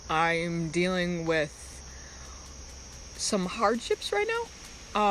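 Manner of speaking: very slow, voice rising as tears come; fighting not to cry, drawn-out words; holding back tears. Real, not acted. Granddaughter telling live viewers of hardship, real near-tears. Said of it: "I wasn't gonna cry".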